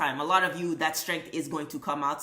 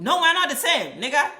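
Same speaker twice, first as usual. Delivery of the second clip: much higher and louder indignant shout; A student shouting; 9 semitones higher, 9 dB louder than usual. Retold or imitated. The A student refusing to be pulled down, loud and indignant. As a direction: shout it like a top student who won't share. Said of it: "you ain't trying to be fucking".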